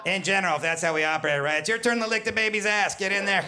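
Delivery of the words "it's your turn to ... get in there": high-pitched, exasperated mock order; mock nagging spouse. One parent to the other over baby duty, high exasperated mock nagging. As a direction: order your partner to take their turn.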